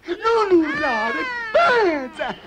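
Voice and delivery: high, slow shout; loud telling-off. Acted. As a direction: yell it out slowly. Shouting at friend.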